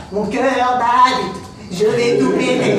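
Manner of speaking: slow, loud, strained voice of a man losing it; panicked shrink. Retold or imitated. Comedian imitating his psy losing it, slow and loud. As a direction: play the psy about to explode, drawn out. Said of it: "Il était comme ça".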